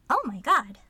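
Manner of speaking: loud, startled burst; shocked exclamation. Acted. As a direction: blurt it out, scandalized. Shocked by what she reads.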